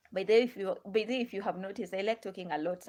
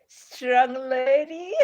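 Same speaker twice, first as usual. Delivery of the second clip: slow, louder, breaking into a laugh; 5 semitones higher, 12 dB louder, much slower than usual. Real, not acted. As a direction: laugh out the words proudly. Laughing at her own resolve.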